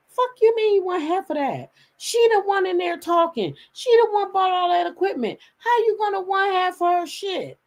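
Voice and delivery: high-pitched indignant rant; retold angry protest, pitched high; losing it. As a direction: imitate an outraged son, voice way up. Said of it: "my son lost it".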